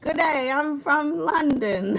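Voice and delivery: slow, high put-on British voice; hammed-up British greeting; mock British accent. Wife mocking British accent for viewers. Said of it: "do the British accent".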